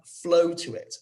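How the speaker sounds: ordinary talk, nothing marked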